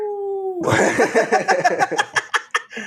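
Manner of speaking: high laughing wolf howl. Pretend. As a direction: throw your head back and howl like a wolf. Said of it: "Did you like my wolf howl?".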